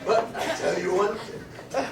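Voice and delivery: ordinary delivery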